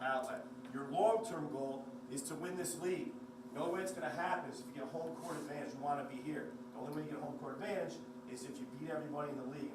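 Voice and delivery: ordinary talk, nothing marked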